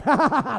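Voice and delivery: gloating staccato ha-ha-ha; hammed-up villain laugh; mock lion laughter. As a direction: imitate hungry lions cackling. Lions' hungry laugh acted out.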